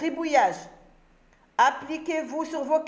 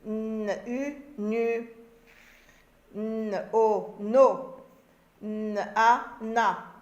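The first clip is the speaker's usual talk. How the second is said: lecture syllabique; much slower than usual. Shown as a demonstration. Syllabes épelées à l'écran.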